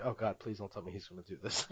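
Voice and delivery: quietly bracing for the worst; a hint of exasperated dread; mild dread. Dreading a Medea impression.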